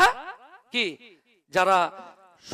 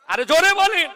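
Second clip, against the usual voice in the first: loud, high exhortation; raised, urging call; calling to the crowd; 9 semitones higher, 10 dB louder than usual. Real, not acted. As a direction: rouse the audience to shout back. Calls on crowd to respond.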